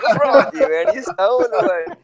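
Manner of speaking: high-pitched, laughing retold question; amused mock disbelief. Retells his teasing question, amused.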